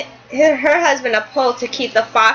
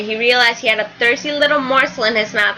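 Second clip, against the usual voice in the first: usual speaking voice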